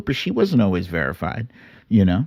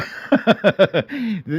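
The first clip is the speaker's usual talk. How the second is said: high laugh; 10 semitones higher than usual. Real, not acted. Laughing at old tweet.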